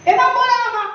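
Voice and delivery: lebih tinggi dan lebih keras, heran polos; anak heran. Retold or imitated. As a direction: bocah tak percaya boleh memukul. Child asks grandma surprised. (cucu bertanya kepada oma dengan heran)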